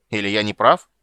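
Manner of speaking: loud, heated challenge; angry outburst. A narrator's reading. King challenging his silent adviser, loud angry outburst. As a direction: throw the question at him angrily. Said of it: "взорвался король".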